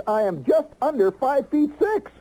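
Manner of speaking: proud announcement. Retold or imitated.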